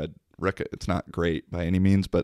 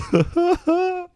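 high laugh. Real, not acted. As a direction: burst of high-pitched laughter. Laughing at own story.

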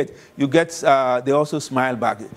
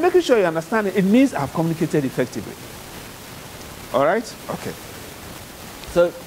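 ordinary talk

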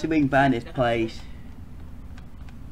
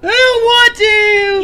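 whiny high voice; 12 dB louder, slower than usual. Acted.

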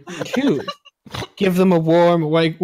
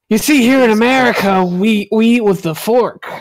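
louder, patronizing mock lecture; condescending explainer; 3 semitones higher, 5 dB louder than usual. Patronizing a foreigner about American customs, loud and mocking. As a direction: explain it loudly like to a child.

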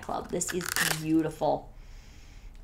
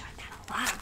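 whispered aside; 10 dB quieter than usual. Real, not acted. Aside while rummaging through box.

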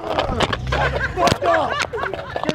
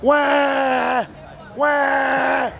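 mock baby crying; much slower than usual. Heckler mocks preacher as crybaby.

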